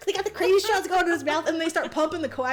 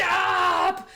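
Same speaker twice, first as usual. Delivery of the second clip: higher-pitched, comically indignant yell; mock monster shout; 5 semitones higher, 4 dB louder than usual. Monster whose tail is going, mock protest shout. Monster yelling at attackers in retelling.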